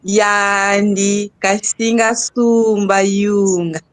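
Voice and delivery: "Kasinga Sumba Yunga" spoken slowly, syllable by syllable; slow careful. Shown as a demonstration. Student carefully repeats the phrase for teacher, slow. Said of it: "Muito bom".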